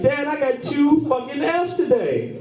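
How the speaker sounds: put-on high-pitched upset child; nine-year-old son whining; whiny kid voice. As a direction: play the kid complaining up high.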